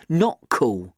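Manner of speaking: tight, jaw clenched, held in anger; through gritted teeth. A narrator's reading. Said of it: "I say through gritted teeth".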